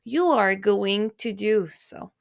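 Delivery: "You are going to do so" said slowly, every word full; slow, no contractions; slow careful speech. Shown as a demonstration. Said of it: "You have to use the proper form with them".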